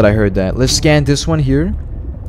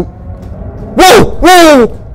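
startled whoa; 6 dB louder than usual. Real, not acted. Spots something startling underwater.

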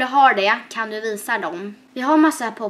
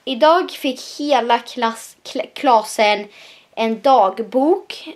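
reading out slowly; 3 dB louder, much slower than usual. Shown as a demonstration. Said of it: "detta var i tvåan".

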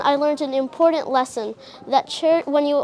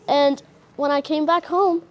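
ordinary talk

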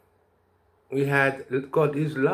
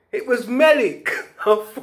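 laughing; 10 semitones higher, 6 dB louder than usual. Real, not acted. Laughing at the coincidence.

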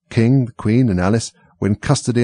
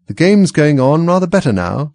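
higher, louder conversational opener; pitched up, making polite chat; polite small talk; 7 semitones higher, 6 dB louder than usual. A narrator's reading. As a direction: brightly keep the conversation going.

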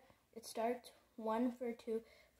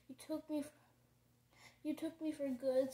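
sobbing; 4 semitones higher than usual. A narrator's reading. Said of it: "Sophie sobbed".

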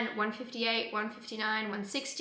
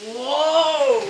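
loud, drawn-out whoa of amazement; amazed whoa; 4 semitones higher, 12 dB louder than usual. Real, not acted. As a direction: blown away, louder and higher. Watching mattress expand.

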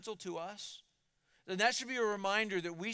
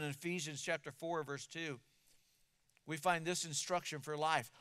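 ordinary talk

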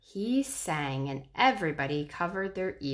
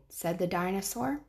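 plain reading voice

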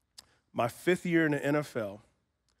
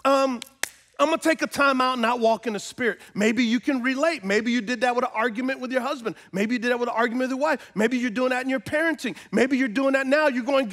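higher-pitched, pressing sermon voice; impassioned preaching; 8 semitones higher, 4 dB louder, faster than usual. Acted. Preacher asks husbands, wives and parents to relate, impassioned. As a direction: press it home to the congregation.

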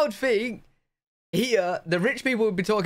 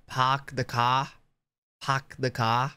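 put-on trying out an R-less Boston accent; imitating Boston accent; 3 semitones lower, slower than usual. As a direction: copy the Boston "pahk the cah". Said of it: "Park the car".

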